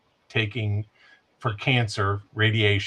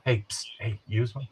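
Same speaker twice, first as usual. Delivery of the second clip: softer hushed shady seller pitch; secretive raspy sales murmur; 6 dB quieter than usual. Pretend. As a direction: murmur it like a back-alley dealer.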